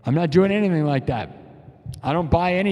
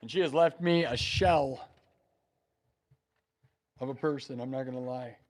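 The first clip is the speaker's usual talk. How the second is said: hushed grief; 4 semitones lower, 10 dB quieter than usual. Real, not acted. Streamer telling chat about his late mom, quietly grieving. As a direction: voice drops to almost nothing, much softer.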